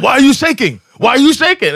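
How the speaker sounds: high, loud mock aggression; confrontational demand. Passenger to arguing ticket inspector, confrontational demand. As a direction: hammer the question, pitch way up.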